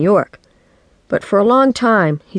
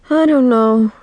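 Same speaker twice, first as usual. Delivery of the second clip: glum best friend; 7 semitones higher, 3 dB louder than usual. A narrator's reading. Chris answers her friend after the play ends, glum. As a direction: sigh the words out, higher-pitched.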